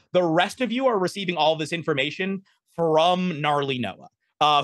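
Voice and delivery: usual speaking voice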